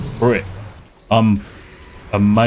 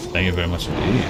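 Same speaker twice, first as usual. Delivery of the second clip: lower, softer, put-on formal voice; mock posh thanks; 6 semitones lower, 5 dB quieter, faster than usual. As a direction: say it like a stuffy gentleman. Repeat, posher and lower.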